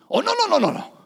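high, loud flustered no; alarmed refusal. Retold or imitated. Driver recoils from the question about his son, alarmed.

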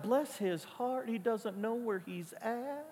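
slow, soft Southern drawl; Southern accent. Retold or imitated. Pastor answering a Southern church member in her accent. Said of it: "I start to kinda take it on".

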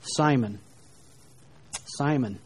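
soft, low Simon, Simon; tender and grieving. Shown as a demonstration. Preacher shows Jesus tenderly calling Simon. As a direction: say his name gently, like a sad father. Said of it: "there's almost no other way to read this".

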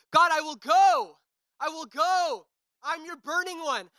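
high, straining cry to God; desperate high worship cry; retold scream. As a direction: fight it out, reaching upward. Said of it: "I legit remember screaming".

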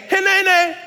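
high ringing call; proclaimed. Shown as a demonstration. Voicing God's call.

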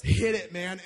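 sudden very loud shout; retold yell. Dad to the ride operator, yelling. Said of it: "just looks at him and yells".

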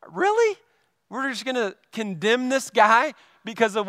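high-pitched disbelieving question; incredulous. Acted. Incredulous reaction to gossip sharer.

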